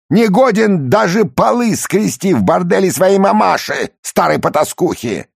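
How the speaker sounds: furious high yelling Italian boss; enraged boss roar. Acted. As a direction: scream the insults at the waiter. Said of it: "вспыльчивый итальянец".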